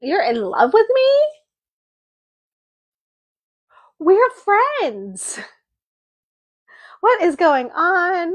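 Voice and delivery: high, squeaky mock disbelief; imitating a friend caught off guard; shocked disbelief. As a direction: shoot up in pitch like you can't believe it. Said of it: "She's like".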